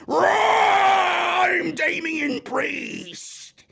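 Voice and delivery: high, loud, drawn-out dopey announcement; goofy comedy geek. Retold or imitated. Mocking the wrestler's old comedy gimmick, high loud goofy shout. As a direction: ham up the silly character version. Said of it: "At least he's not fucking Lou Ferrigno".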